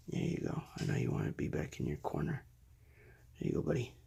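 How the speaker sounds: soft, breathy soothing; quiet at night. Real, not acted. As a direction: hush the pet back to sleep. Settling tortoise back down.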